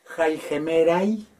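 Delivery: "jaigemerai" said slowly, word by word; slow careful "jaigemerai"; slow Greek demo. Shown as a demonstration. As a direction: read "jaigemerai" slowly for students. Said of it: "para decir los días".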